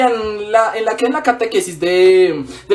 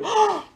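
short high horrified gasp; mock shocked gasp; 11 semitones higher, 4 dB quieter than usual. Shocked reaction to eating God's body.

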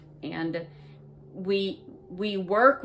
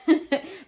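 sudden high laugh breaking into the quote; bright real laugh; burst of laughter; 11 semitones higher, 7 dB louder than usual. Real, not acted. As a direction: laugh out loud mid-sentence. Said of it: "And I started laughing".

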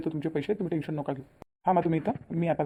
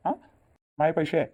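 usual speaking voice

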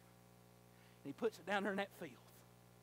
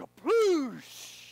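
splash sound; 7 semitones higher, 14 dB louder than usual. Retold or imitated. Dog falls into septic tank.

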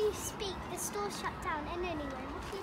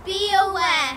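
announcer warning; 3 semitones lower, 5 dB louder, slower than usual. Acted. Mock public warning.

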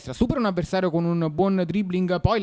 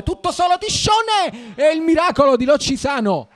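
excited call; 7 semitones higher, 5 dB louder than usual. Real, not acted. Big save moment.

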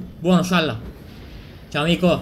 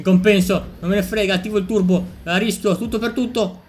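louder, higher, all in; excited rush; 6 semitones higher, 5 dB louder than usual. Real, not acted. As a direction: go for broke with the turbo. Rischia col turbo. (tutto per tutto, forte ed eccitato)

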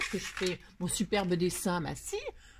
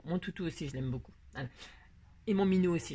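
ordinary talk

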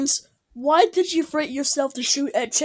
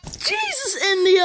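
shocked outcry; 6 semitones higher than usual. Real, not acted. Shocked reaction to video.

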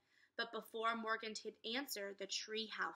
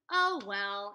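ordinary delivery

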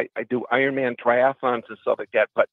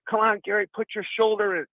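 lightly voiced grandpa, pitched up; urging grandpa; 8 semitones higher than usual. Grandpa harassing his grandson at work, mildly urging. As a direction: imitate a grandpa pushing the kid on. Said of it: "like a grandpa would do".

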